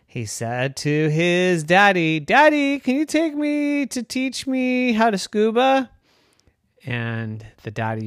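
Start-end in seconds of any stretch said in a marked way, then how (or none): 2.2-5.9 put-on high, pleading child voice; a small kid begging their dad; eager little unicorn. Rowan the young unicorn asks.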